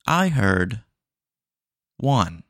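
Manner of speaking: loud, flat machine confirmation; robot voice. Acted. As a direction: mishear like a dumb bot. AI bot confirms.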